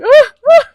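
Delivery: two loud, high mock-terrified gasps; panicked yelps. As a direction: yelp twice, clutching on in terror.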